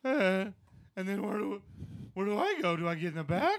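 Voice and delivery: put-on high, loud whiny teen voice; nerdy teen voice. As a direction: plead it up high. Dan's high nervous voice.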